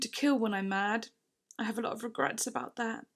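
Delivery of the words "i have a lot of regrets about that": usual speaking voice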